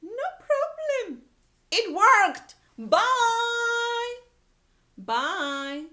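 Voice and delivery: very high, loud chirpy birds; birds' voices. A narrator's reading. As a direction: sing it out like little birds. Birds answering in story.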